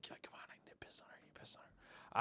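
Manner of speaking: unvoiced, fast urgent whisper to himself; a hushed mock plea while waiting to pee. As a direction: coax yourself under your breath, hurried.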